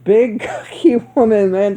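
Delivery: laughing through big cookie woman; real giggle mid-phrase. Real, not acted. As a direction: breaks up laughing on the word cookie. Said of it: "I was dying".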